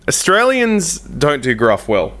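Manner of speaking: ordinary talk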